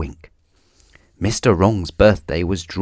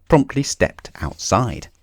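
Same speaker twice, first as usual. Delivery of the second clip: ordinary delivery, nothing marked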